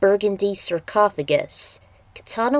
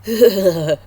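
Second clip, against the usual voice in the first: a burst of laughter, louder; short loud laugh; 7 dB louder than usual. Acted. Loud laugh between lines.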